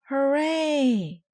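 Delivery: high, loud hooray; fans cheer. A narrator's reading. Fans cheering the Mites, loud high hooray. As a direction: cheer like a stadium crowd. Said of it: "shouted the fans".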